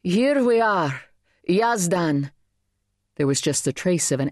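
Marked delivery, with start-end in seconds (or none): 0.0-2.3 high, slow, ringing call across a crowd; a clear voice calling over the crowd; clear carrying voice. A narrator's reading. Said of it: "same clear, carrying voice".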